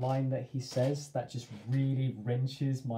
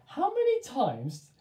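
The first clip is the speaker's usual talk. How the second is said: exasperated mum; 7 semitones higher, 6 dB louder than usual. Retold or imitated. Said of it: "my mum always says to me".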